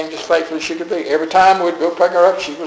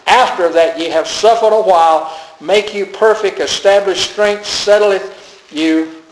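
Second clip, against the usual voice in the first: emphatic preacher's Bible reading; scripture reading; 3 dB louder than usual. Acted. Preacher reading the promise of grace to the church, emphatic scripture reading. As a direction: proclaim the verse, leaning on the key words.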